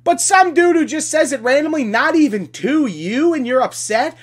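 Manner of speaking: normal talking voice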